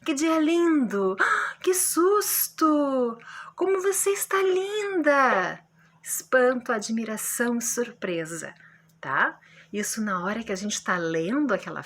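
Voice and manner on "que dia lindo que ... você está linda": higher, louder and slow, full of wonder; teacher acting out amazed exclamations. Shown as a demonstration. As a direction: gasp and marvel at each line. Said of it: "surpresa, espanto e admiração".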